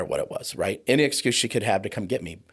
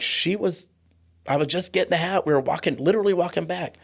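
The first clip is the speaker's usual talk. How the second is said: scared pleading; 8 semitones higher than usual. Retold or imitated. Boy pleading with violent dad.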